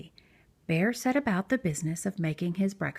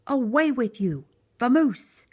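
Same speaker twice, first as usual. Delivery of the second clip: louder, rising shout; angry bear; 4 semitones higher, 5 dB louder than usual. A narrator's reading. Bear chasing the mouse off, louder shout. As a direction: shoo the mouse away loudly.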